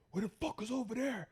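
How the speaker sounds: mock-incredulous challenge, pitched high; aggressive cop retold. Cop challenging him on the street, high-pitched mock incredulity. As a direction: throw it back as a disbelieving cop, high-voiced.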